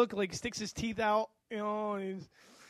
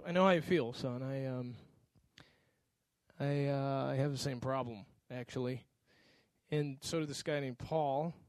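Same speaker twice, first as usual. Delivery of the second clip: tender comfort; 6 semitones lower than usual. Retold or imitated. Father comforting crying son.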